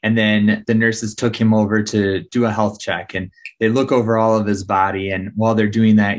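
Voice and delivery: usual speaking voice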